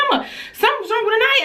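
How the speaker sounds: put-on high and fast, a kid rushing the news; high rushed child's alarm; frantic child voice. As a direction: squeal it out like a scared little girl.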